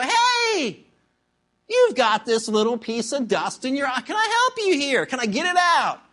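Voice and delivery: high, hammed-up offer of help; oblivious eager helper. Man with beam in eye to neighbour, blithely helpful.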